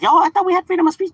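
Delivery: high, loud mock protest; mock indignant customer. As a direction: ham up an offended customer.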